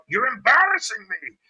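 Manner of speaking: high, loud imitation of scolding; mock angry handler. Angry handler scolding her dog, mock shout. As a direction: yell it as the furious handler. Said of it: "That chick's angry".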